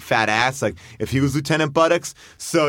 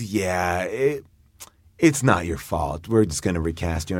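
a hint of a regretful boss, lower; lightly voiced low producer; 8 semitones lower than usual. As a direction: soften it like bad news.

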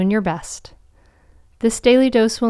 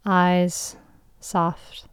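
guided meditation. Acted. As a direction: guide them gently into calm.

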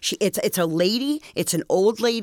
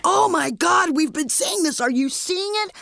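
much higher, amazed gush; excited shock; 10 semitones higher than usual. Retold or imitated. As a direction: blurt it out, stunned and thrilled. Family excitedly confirming the ghost.

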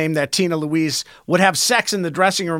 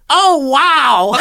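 high mock wow; hammed-up fake interest; fake enthusiasm; 5 semitones higher, 4 dB louder than usual. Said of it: "when he fakes enthusiasm".

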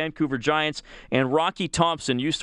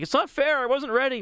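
whiny goalie; 11 semitones higher than usual. Retold or imitated. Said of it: "he was like".

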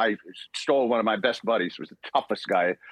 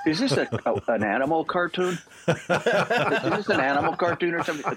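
laughing disbelief; 7 semitones higher than usual. Real, not acted. Disbelief at the Sabretooth casting.

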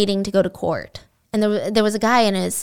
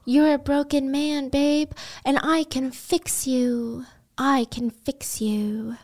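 put-on smitten girlfriend; 4 semitones higher, 4 dB quieter, slower than usual. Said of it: "Cindy was like".

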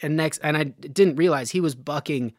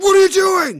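much higher, much louder angry shout; furious yell; 11 dB louder than usual. Retold or imitated. Roping partner yelling at the narrator mid-run, furious shout. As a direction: yell at your partner in outrage. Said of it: "He's furious".

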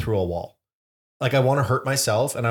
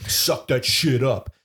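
tough dad voice; slower than usual. Retold or imitated. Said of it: "kind of dad that's like".

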